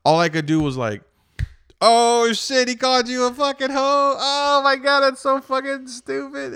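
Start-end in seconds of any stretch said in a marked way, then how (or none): 1.8-6.5 very high, loud incredulous squeal; hysterical reaction. Retold or imitated. Driver to his insulted girlfriend, high hysterical reaction. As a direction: crow at the absurd insult, pitch way up.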